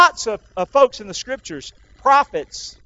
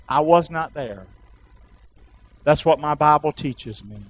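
low, soft confession of faith; solemn testimony; 6 semitones lower, 6 dB quieter than usual. Real, not acted. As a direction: drop low and quiet, sincerely testify.

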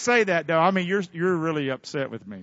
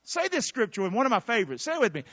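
normal talking voice

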